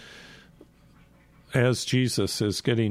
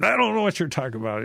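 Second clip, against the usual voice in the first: fast, higher, insistent denial; stronger denial; 8 semitones higher, 3 dB louder, much faster than usual. Retold or imitated. Peter to the bystander, quick heated denial. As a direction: brush the accuser off in a rush. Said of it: "Peter even gets stronger every time".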